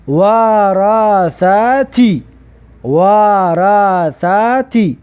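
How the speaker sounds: very slow, drawn-out syllables; slow teacher's model reading. Shown as a demonstration. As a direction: stretch each syllable for learners to copy. Teacher models the blended word.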